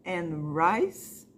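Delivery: ordinary talk, nothing marked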